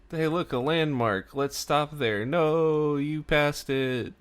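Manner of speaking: high-pitched excited calling out, drawn out at the end; backseat pleading. Retold or imitated. Frasier and Niles call to Martin as he drives past a landmark, high excited pleading. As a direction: imitate passengers pestering the driver.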